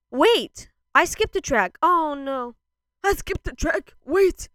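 loud, high, sudden panic; alarmed outburst. Real, not acted. Album reviewer realizing a track was skipped, loudly alarmed. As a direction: cry out as if stopping everything.

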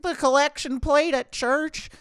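put-on high, slow old lady voice. Old lady contestant answering the host, in a high slow voice. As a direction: pipe up like a sweet churchgoing granny.

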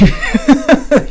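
high, loud cackling laugh; real laughter bursting out. Real, not acted. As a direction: crack up laughing at yourself. Laughing at own impression.

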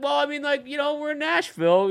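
high, lightly voiced sixty-three-year-old dad; dad's voice. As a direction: put on his father's persuading voice. Voicing his dad in story.

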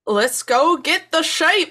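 a clumsy try at a Scottish farmer; bad Scottish accent. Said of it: "an attempt at a Scottish accent".

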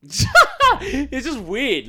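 high laugh through the words. Real, not acted. Laughs while admitting frustration.

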